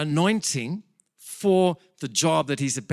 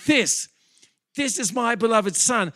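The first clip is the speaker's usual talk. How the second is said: proud, raised booming voice; a father proudly showing off his boy; boasting father; 8 semitones higher, 5 dB louder than usual. Retold or imitated. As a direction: boast about your son. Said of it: "It's like he's boasting".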